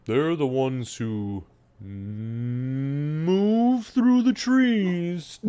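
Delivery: slow, halting cow voice swelling into a moo; ponderous lowing cow speech; talking cow. Acted. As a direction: stretch the words, rising into a long moo. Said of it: "She looks down and she says".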